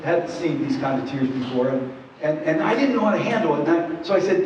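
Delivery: ordinary delivery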